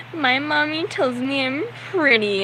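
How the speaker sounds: mock-bratty, high-pitched protest; bratty teenage girl. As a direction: imitate a movie teen girl sulking. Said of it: "bratty, stereotypical movie teenage girl".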